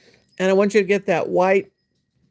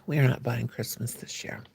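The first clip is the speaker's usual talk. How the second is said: soft secretive whisper, lower; 7 semitones lower, 8 dB quieter than usual. Real, not acted. Whispered confession.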